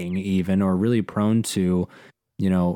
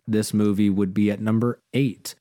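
normal talking voice

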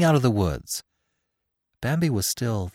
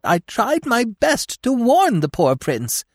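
very high, loud, drawn-out squirrel protest; emphatic protest; 14 semitones higher, 5 dB louder, slower than usual. A narrator's reading. Squirrel insisting he warned the prince, emphatic protest. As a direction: protest louder, pitched right up.

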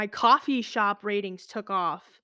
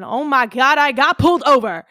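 loud, raised exclamation; worked-up exclamation; 5 semitones higher, 9 dB louder than usual. Real, not acted. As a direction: tell it with loud disbelief. Telling of being pulled over.

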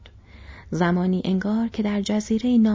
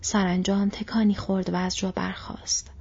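usual speaking voice

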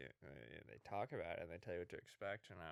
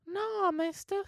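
put-on much higher, louder child voice; little boy voice; 12 dB louder, much slower than usual. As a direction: squeak it like a small kid answering. Caller plays a boy.

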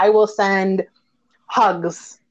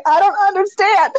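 mock confusion; 11 semitones higher, 3 dB louder than usual. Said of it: "I'm like".

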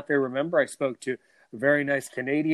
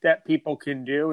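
ordinary delivery

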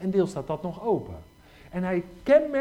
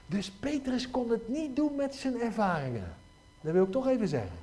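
normal talking voice

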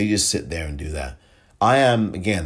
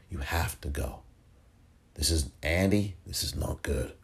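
Chuck Schumer; 2 semitones lower, 7 dB quieter than usual. Acted. Senator urging the governor to resign, quiet badly faked impression. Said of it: "that's my worst Schumer impression".

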